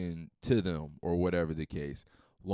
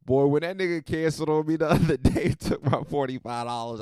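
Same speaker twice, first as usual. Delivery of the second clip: normal talking voice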